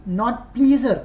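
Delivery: "pleaser" said as plee-zer, with emphasis; wrong take, "pleaser" rhyming with freezer; mispronounced pleasure. Shown as a demonstration. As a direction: stress "pleaser" with long ee and z as the error. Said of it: "pronunciation का बहुत ध्यान दीजिएगा".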